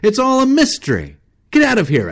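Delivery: very high, loud outburst; furious guide shouting him down; angry cry. Acted. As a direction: yell it in exasperation. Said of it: "cried Travis".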